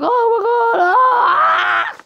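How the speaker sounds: high, crying shout; panicked cry. Acted. Man losing his arm cries out in panic. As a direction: cry out in shock and pain. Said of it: "he's like".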